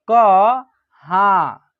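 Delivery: कहाँ slowly, syllable by syllable, with the nasal drawn out; slow dictation of कहाँ; broken into syllables. Shown as a demonstration. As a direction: stretch कहाँ so the nasal vowel shows. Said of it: "तोड़ के पढ़िएगा".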